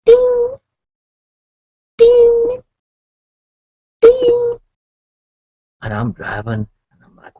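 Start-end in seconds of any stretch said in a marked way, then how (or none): 0.0-4.6 high, loud sung "ding"; ping it out like a dashboard alert; piercingly high single chime notes; imitated warning chime. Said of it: "all of a sudden we hear".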